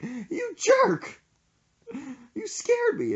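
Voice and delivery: very high, upset squeal; almost crying, imitated. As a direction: squeak it like a badly spooked friend. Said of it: "he starts, like, almost crying".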